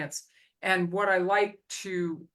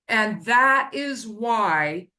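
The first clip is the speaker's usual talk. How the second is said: slow, louder emphasis on that is why; slow emphatic stress; 4 semitones higher, 6 dB louder, much slower than usual. Real, not acted. Host driving home his point to the guest, slow and emphatic. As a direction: lean hard on every word.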